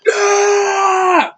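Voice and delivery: long, high, loud screamed no; imitated scream. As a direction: scream it out like a panicked man. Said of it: "that guy screaming, going".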